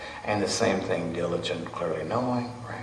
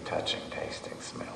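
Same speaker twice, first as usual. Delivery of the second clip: whisper; 8 dB quieter than usual. Real, not acted. Listing senses, fading.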